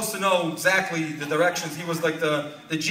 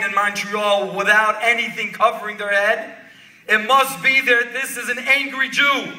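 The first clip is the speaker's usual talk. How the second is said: raised, emphatic storytelling at a high pitch; impassioned preaching; 4 semitones higher, 6 dB louder than usual. A narrator's reading. Rebbe explains the bareheaded man's anger, retold with preacher's fervor. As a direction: drive each phrase home to the congregation.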